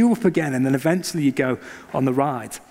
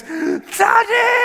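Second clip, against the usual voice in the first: terrified cry; 3 dB louder than usual. Retold or imitated. Terrified child at hill crest.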